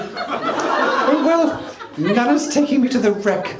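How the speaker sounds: high, loud little dog voice; Pretty the dog's voice. Retold or imitated. Man voices wife's little dog on the phone, high and loud. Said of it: "a voice with inflection and an accent".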